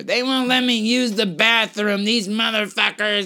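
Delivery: high, slow ranting stranger; crazy lady ranting. Retold or imitated. Man imitating a lady ranting at nothing, high and slow. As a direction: whine it out at nobody. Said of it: "she's screaming at nothing".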